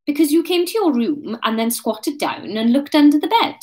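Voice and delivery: matter-of-fact accusation. A narrator's reading. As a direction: state the evidence squarely. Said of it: "Kate said matter-of-factly".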